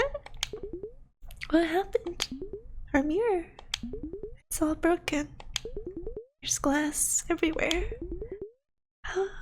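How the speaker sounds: high, soft, stunned little voice; small and high, softly shocked by what you find; frightened child. Acted. Plays frightened child at broken mirror.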